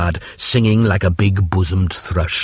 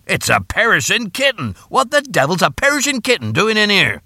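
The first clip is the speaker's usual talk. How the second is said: much higher, exclaiming father; startled dad discovering something absurd; indignant astonishment; 12 semitones higher than usual. Acted. Father startled by kitten in oven.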